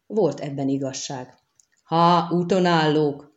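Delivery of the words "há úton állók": slow, drawn-out knightly call; ringing cry. A narrator's reading. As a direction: call it out ringingly, stretching the words. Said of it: "rikkantott fel csengő hangon". (csengő hangú rikkantás)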